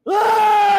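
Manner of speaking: high, loud, sustained "aah"; mock scream. Host imitating Superman after killing a guy, loud mock scream. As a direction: send up a movie hero's scream at full volume. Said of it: "He's screaming that he had to".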